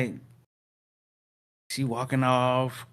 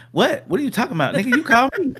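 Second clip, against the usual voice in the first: higher, louder, mock-baffled; hammed-up confused cousin; cousin on the phone; 12 semitones higher, 4 dB louder, faster than usual. Cousin on the line denying he called, high pitched. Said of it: "Cuz on the line like".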